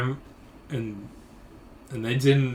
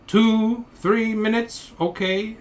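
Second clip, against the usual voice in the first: high, spaced-out broken English; mock a clerk's careful broken English; broken English, each chunk pitched up and separate; imitated broken English; 7 semitones higher, slower than usual. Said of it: "in broken English, in a louder voice".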